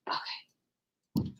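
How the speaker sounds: a breathy whispered okay under her breath. Real, not acted. Fixing her laptop charger mid-stream, she whispers to herself. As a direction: whisper it to yourself, off mic. Muttering to herself while swapping seats.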